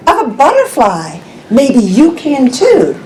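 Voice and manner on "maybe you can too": ordinary delivery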